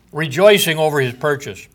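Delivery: usual speaking voice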